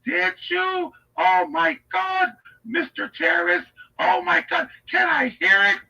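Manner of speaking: high, thrilled fangirl voice; Leslie Jennings. Acted. Admirer begging to hear his song, thrilled. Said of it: "doing that Leslie Jennings voice".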